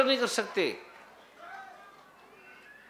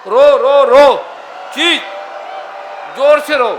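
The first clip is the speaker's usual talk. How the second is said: loud, higher-pitched commands to a crowd; shouted urging; 3 semitones higher, 10 dB louder, slower than usual. Real, not acted. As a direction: shout them into crying louder. Leader urging crowd to cry loudly.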